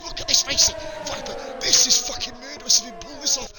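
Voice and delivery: live race call of a daring move, excited; excited commentary. Real, not acted. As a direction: build the thrill of the overtake. Double overtake unfolding.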